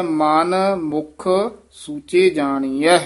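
slow, with ਮਨ and ਮੁਖ kept separate; slow, word-by-word teaching read; ਮਨ ਮੁਖ apart. Shown as a demonstration. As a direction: say ਮਨ, pause, ਮੁਖ. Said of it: "ਇੱਥੇ ਹੈ".

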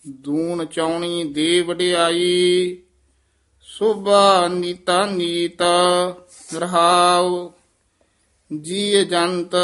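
measured, chant-like recitation of the hymn; scripture recitation. Acted. Teacher recites the hymn for the class, measured and devout. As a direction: read the verse slowly with devotion.

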